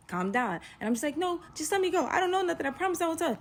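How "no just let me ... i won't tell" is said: high, frantic begging; panicked pleading. Retold or imitated. Frightened woman begging her date through the door, panicked pleading. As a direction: plead high in acted terror.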